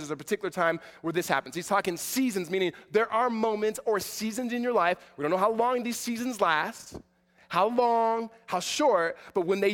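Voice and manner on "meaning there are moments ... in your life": ordinary talk, nothing marked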